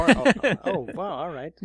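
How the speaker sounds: high, loud mock gasp of surprise; a hammed-up oh of shock. Real, not acted. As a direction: play up being taken aback. Teasing reply to "or at all.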